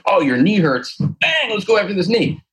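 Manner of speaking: mock eagerness, pitch leaping high; overeager trainer. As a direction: pounce on the problem in a high, excited voice. Acts out overeager trainer's reaction.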